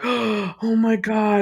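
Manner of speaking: mock horrified gasp. Onlookers as the glass breaks, slow mock horrified gasp. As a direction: act out stunned horror with a drawn-out delivery. Acted horror at plane exploding.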